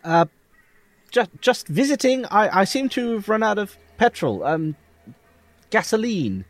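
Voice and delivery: high, hesitant stammer; flustered traveller caught off guard; nervous character voice. Acted. Player voices his character.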